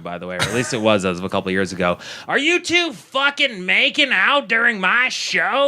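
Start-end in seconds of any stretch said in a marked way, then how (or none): 2.3-5.7 high, drawn-out heckling screech from the stage; Judy Tenuta impression. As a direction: imitate a comic's hard-to-listen-to voice, high and stretched. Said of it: "her voice is hard to fucking listen to".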